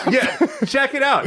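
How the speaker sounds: lightly voiced Southern host; high chirpy Mississippi host; Huell Hauser impression. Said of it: "from Mississippi".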